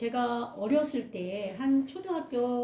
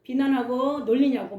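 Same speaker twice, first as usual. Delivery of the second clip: put-on higher and louder, scolding the boy; a teacher scolding the bully in the hallway, raised voice; 3 semitones higher, 5 dB louder than usual. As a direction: tell him off sharply like a strict teacher. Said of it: "야단치는 거예요".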